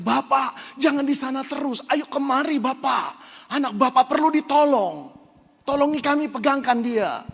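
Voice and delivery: high, loud urgent plea from a nurse; urgent call. Retold or imitated. Nurse calls the father to help hold his son, loud and urgent. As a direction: call the father over, fast and pressing.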